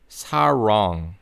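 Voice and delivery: sarong with a curled English R; American R. Shown as a demonstration. Teacher modelling 사랑 as sarong, American R. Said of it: "One more time".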